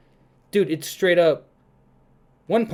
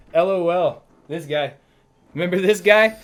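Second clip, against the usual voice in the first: mock jeering; 4 semitones higher, 6 dB louder than usual. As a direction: imitate gloating fans, louder and higher. Acting out mocking fans.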